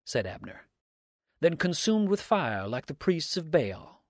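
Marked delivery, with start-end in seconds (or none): 1.4-4.0 slow, high, loud mock question; taunting. A narrator's reading. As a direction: keep needling the accuser, drawn out. Dix keeps taunting Abner.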